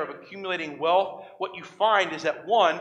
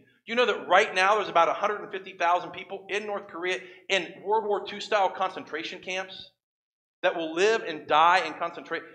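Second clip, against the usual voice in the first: ordinary talk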